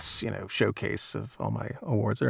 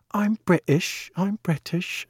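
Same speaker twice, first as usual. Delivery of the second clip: put-on higher, playful child's British accent; 10 semitones higher, slower than usual. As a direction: mimic a five-year-old putting on a British accent. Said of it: "started riffing on a British accent".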